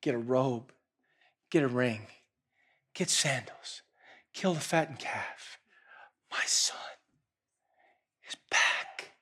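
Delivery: soft, low commands; quiet overflowing joy; hushed joyful orders. Acted. Father ordering servants for the feast, hushed. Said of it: "turns to his servants and says".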